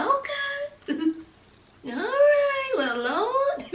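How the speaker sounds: high, soft, drawn-out words; scandalised reaction. Retold or imitated. Viewer reacting to the back room scene, softly scandalised. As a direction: react delicately, taken aback and amused.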